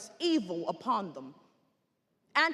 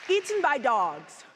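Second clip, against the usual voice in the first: ordinary delivery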